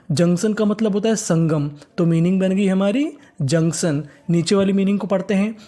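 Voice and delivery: usual speaking voice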